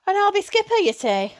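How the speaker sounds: a mother startled by the idea; mother surprised. Acted.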